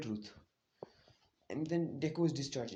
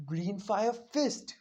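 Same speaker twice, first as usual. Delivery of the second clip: slow, higher, drawn-out battle call; attack name call; 5 semitones higher, much slower than usual. Retold or imitated. Izumi calling her attack as she hits Deku, slow and drawn out. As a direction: announce the move like an anime fighter. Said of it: "she's like".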